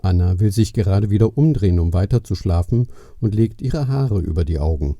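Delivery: usual speaking voice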